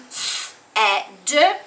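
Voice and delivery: S, e, d pulled apart, each sound alone. Shown as a demonstration. Teacher models the three sounds of said. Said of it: "I say those sounds".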